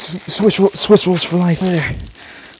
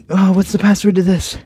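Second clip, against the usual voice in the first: ordinary talk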